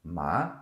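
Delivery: "Ma" gliding upward like a question; second tone, rising. Shown as a demonstration. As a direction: overpronounce "Ma" with a rising pitch. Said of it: "Like I'm overpronouncing".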